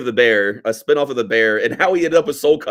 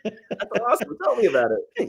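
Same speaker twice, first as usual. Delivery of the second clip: much higher, falsetto-ish put-on voice; mock eager fan; 10 semitones higher, 4 dB quieter than usual. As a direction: gush like a star-struck fan. Mocking Chili's eager reply.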